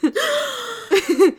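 high, loud, crying laughter; shrieking laugh. Real, not acted. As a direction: laugh till tears. Laughing at ending.

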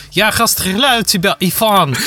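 hammed-up sneering fascist, mispronounces «расстреляю»; can't pronounce R. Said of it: "фашисты, которые не выговаривают букву «Р»".

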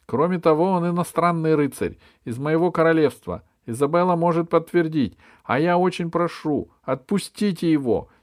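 high, loud, urgent appeal; impassioned high-voiced plea; pleading girl. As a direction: beg the court earnestly to free a friend. Alice pleads for the boy.

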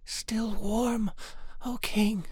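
high, breath-starved reply; faint gasp. A narrator's reading. Stiff, numb girl answering the king, gasping. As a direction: gasp it out high, with a break between phrases. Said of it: "could just gasp".

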